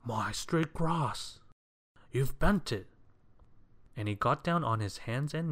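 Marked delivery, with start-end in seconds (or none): 0.0-2.9 higher, slow, aghast wail turning to accusation; drawn-out, indignant lament; fussy outcry. A narrator's reading. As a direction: cry out in fussy dismay. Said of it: "cried Mister Fussy".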